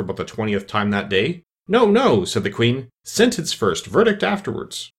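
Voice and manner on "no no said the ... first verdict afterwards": high commanding Queen of Hearts; imperious queen. Acted. Queen contradicts the king before the court, imperious. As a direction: overrule him sharply.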